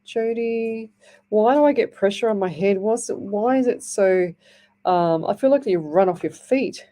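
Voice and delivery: normal talking voice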